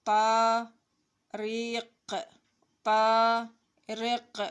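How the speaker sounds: loud, stretched-out syllables; slow model reading. Shown as a demonstration. Teacher spelling out a name for children, slow loud reading. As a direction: sound it out loudly and very slowly.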